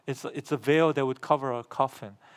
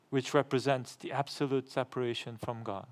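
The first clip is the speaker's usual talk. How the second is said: ordinary talk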